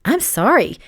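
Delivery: fake, lilting dismay; feigned surprise. A narrator's reading. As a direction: pretend to be sorry. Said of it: "Gracie feigned surprise".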